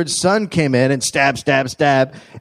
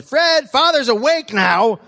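daughter's announcement; 9 semitones higher, slower than usual. Retold or imitated. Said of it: "opened a door and said".

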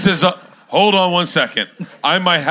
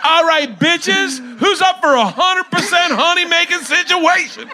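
hyped announcer call; 10 semitones higher than usual. Pretend. Cohost shouting the new catchphrase to everyone, hyped. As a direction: hype it up, higher, like a party host.

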